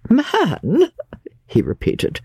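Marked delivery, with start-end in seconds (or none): 0.1-0.9 high, sarcastically surprised query; sarcastic surprise. A narrator's reading. Invalid querying the word man, sarcastically surprised. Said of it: "sarcastic surprise".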